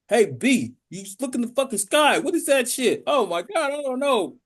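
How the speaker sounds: mock-frightened alien voice; alarmed alien. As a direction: gasp at a thing falling from the sky. Alien spots rocket, panicked.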